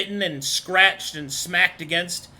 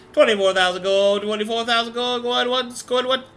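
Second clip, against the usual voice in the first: auctioneer; 4 semitones higher than usual. Acted. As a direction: rattle off the bids like an auctioneer. GM voices auctioneer calling bids.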